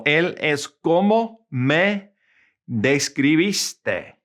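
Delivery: slow teaching demo with pauses between phrases; slow, word-by-word Spanish. Shown as a demonstration. Teacher giving students an example, slow and word by word. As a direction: say it slowly for learners, piece by piece.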